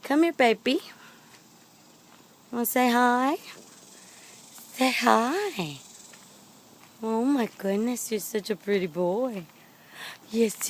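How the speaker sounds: high, slow, loud cooing. Real, not acted. Cooing at the horse.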